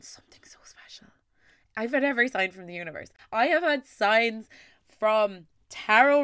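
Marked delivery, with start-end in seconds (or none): 0.0-1.1 a soft, secret whisper. Real, not acted. Host confiding hopes to listeners, whispered.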